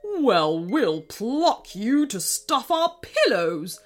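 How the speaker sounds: slow, sneering king voice; sneering taunt. Acted. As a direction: sneer it with contempt. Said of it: "sneered King Krusty".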